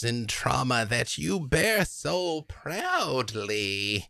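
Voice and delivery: slow, drawn-out crone savoring each word; a covetous hag, lingering and slow; old hag voice. Acted. Hag to Tatiana, slowly admiring her scars. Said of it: "and she says".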